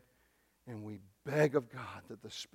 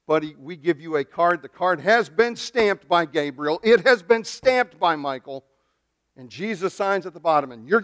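hammed-up mock offer; mock salesman pitch; 3 semitones higher, 10 dB louder than usual. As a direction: sell the fake heaven card with a sneer. Mocking a fake heaven card.

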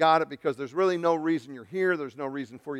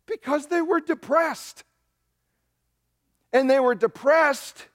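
high, slow, drawn-out emphasis; pitched way up, each phrase stretched for impact; emphatic preaching; 11 semitones higher, 4 dB louder, slower than usual. Real, not acted. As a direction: drive it home in a raised, high voice. Preacher drives home the reason.

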